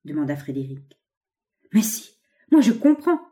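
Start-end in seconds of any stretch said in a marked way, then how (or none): 1.7-3.2 higher, louder eager cry; excited exclamation. A narrator's reading. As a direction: burst out with sudden insight. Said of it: "s'écria monsieur Bondona".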